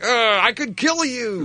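high, slow, strained fury; mock seething threat. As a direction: seethe at a girlfriend, hammed-up. Retold seething look at girlfriend.

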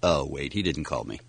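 low, soft, deflated realization; deadpan letdown. Acted. Catching himself after the imagined call, deadpan. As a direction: drop the excitement, go low and quiet.

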